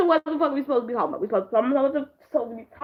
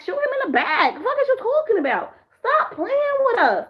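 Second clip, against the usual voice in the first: mock yelling at the TV; 9 semitones higher than usual. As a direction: egg the police on, laughing. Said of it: "I was like".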